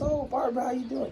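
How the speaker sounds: light up at a familiar name; old neighbour on the phone recognizing Barbara, happily surprised; pleased surprise. Retold or imitated. Old friend greets mom happily.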